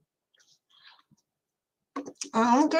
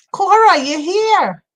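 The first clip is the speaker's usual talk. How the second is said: excited greeting; 8 semitones higher, 9 dB louder, slower than usual. Real, not acted. Greeting a friend joining live.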